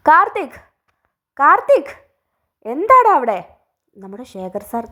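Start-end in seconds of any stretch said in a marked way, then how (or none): 0.1-0.6 shouted high call with a falling end; loud call. Acted. Father bellowing for his son upstairs, loud falling call. 1.4-1.9 shouted high call ending on a rise; loud call. Acted. Father calling his son again, loud rising call. 2.7-3.4 loud high demanding yell; shouting. Acted. Father demanding why his son is silent, loud shout.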